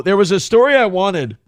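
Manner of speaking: burpy, interrupted speech; talking while burping. Real, not acted. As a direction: talk through a burp. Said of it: "I'm burping like an asshole".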